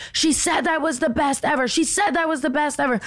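high, repeated mock yell; mock screaming nurse. Imitating the nurse pacing downstairs, high mock yell. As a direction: chant it like a pacing, frantic nurse. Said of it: "screaming over and over again".

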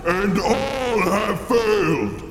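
slow, booming villain voice; menacing dark lord, slow and grand. Acted. Villain speech in ad sketch.

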